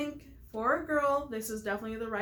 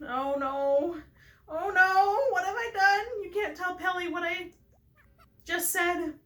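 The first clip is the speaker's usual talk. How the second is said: high, loud melodramatic lament; 8 semitones higher, 6 dB louder than usual. Acted. Game player voicing a dramatic character's confession, melodramatic. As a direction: overdo the horror, pitched up. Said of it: "And melodramatic stuff like that".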